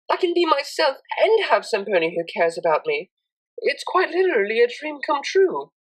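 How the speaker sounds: put-on a hopeful young mare opening up; Octavia's voice. Octavia speaks in story.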